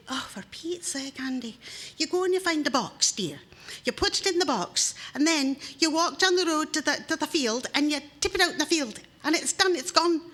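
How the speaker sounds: wife walking her flustered husband through it; patient wife. Acted. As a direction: talk him through it like a child. Wife explains what to do.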